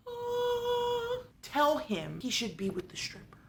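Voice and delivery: a high sung "Ah" then a hushed whisper; mock divine whisper from above; voice of God. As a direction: play a heavenly voice whispering orders. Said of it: "he hears the voice of God".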